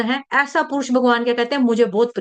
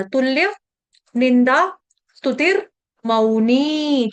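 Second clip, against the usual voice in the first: तुल्य, निंदा, स्तुतिर, Mauni each said apart with pauses; broken into pieces; much slower than usual. Shown as a demonstration. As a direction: chop the word into four separate parts. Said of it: "चार टुकड़ों में बांट दिया".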